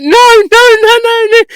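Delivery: high, loud, frantic no's; squeaky loud mock-panic protest to a star client; panicked denial. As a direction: scramble to take it back in a panic. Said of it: "but I shit myself".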